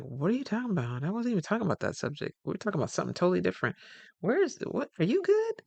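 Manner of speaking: imitating a bewildered coworker; incredulous confusion. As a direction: pile on the disbelief, climbing high.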